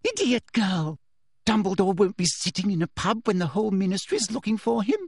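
high, exasperated scolding; restrained shout. A narrator's reading. Teacher berates girl in disappointment, mild shout. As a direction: scold sharply without extra volume. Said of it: "shouted Umbridge".

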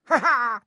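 high, loud mocking cackle; gleeful cackle. A narrator's reading. Peeves having fooled Filch, gleeful cackle.

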